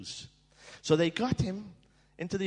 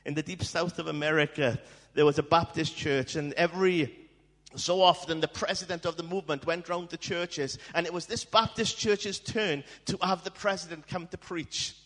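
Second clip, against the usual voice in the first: ordinary talk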